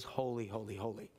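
hushed holy. Acted. Preacher naming God's chief attribute to the church, reverent hush. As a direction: let it sink to a hush. Emphatic climax of sermon.

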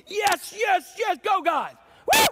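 high, loud cheering shout; priests shouting. Retold or imitated. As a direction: yell yes like a fan. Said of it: "You got these priests going".

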